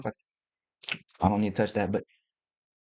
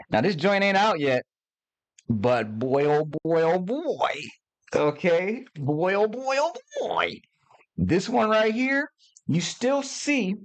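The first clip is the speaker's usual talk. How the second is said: excited; 7 semitones higher, slower than usual. Real, not acted. Hyped about the unreleased book.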